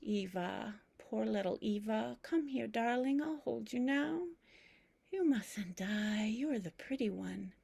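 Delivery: soft, tender grandmother's croon; tender cradling. Acted. Grandmother cradling her pillow as her sister, soft and tender. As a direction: comfort a dying little sister gently.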